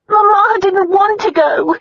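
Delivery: high, slow, sobbing voice; crying. A narrator's reading. Sister sobbing to her brother, high and slow. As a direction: cry the words out. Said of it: "Diana cried".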